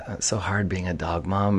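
breathy whispered imitation; whispered mock quote. Mocking a dog mom post.

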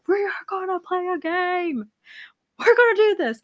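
high, loud insistent cry; insistent high shout. Retold or imitated. To tired family on phones, loud high demand. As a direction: force the game on them, shouting up high. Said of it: "I was just like".

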